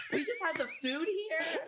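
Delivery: high laughing through words; laughing squeal. Real, not acted.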